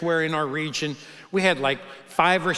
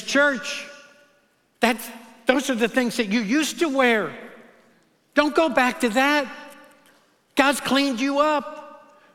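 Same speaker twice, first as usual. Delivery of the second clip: higher-pitched urgent exhortation; impassioned preaching; 6 semitones higher than usual. Acted. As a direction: preach it to the church with fire. Voicing Paul exhorting church.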